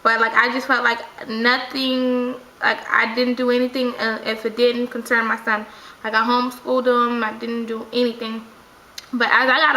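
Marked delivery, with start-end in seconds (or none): none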